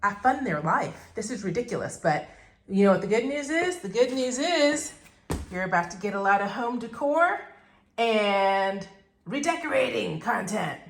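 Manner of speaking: usual speaking voice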